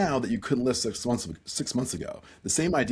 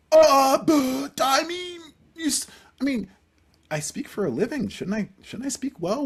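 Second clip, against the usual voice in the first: feigned innocence; 4 dB louder than usual. Retold or imitated. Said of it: "So I asked her".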